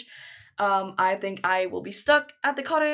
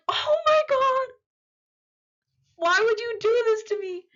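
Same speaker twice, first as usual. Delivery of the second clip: high-pitched fangirl wail; mock anguish; 13 semitones higher, 5 dB louder than usual. Fan reacting to an angsty couple comparison, mock-anguished wail. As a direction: wail it in hammed-up despair.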